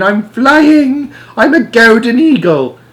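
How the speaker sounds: put-on very high, loud gleeful old lady; excited. A narrator's reading. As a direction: squeal with delight. Grandma delighted while flying.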